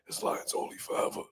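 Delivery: mock cracked, voiceless whisper; faint hoarse imitation of a choked-up boast; voice giving out. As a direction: imitate a fighter losing his voice.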